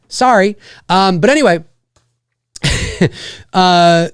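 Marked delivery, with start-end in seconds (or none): 0.1-0.6 American "sorry" with open first vowel; American pronunciation. Shown as a demonstration. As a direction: say "sorry" like it's spelt SARRY. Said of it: "like it's S A R R Y".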